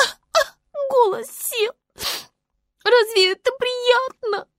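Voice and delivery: high little voice, dreamy then indignant; high-pitched fairy-tale creature getting worked up; aggrieved little star. Acted. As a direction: pour out your grievance in a tiny high voice.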